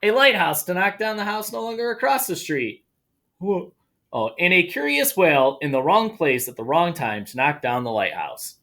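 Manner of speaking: animated storytime narration; story reading. Acted. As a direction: read it like a children's picture book. Reads picture book aloud.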